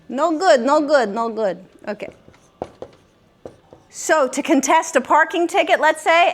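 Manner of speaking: ordinary talk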